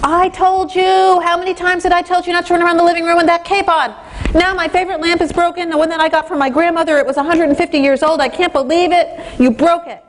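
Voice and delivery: high, furious scolding parent; losing it at the kids. Pretend. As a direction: blow up at the kids over the broken lamp. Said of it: "you completely lose it".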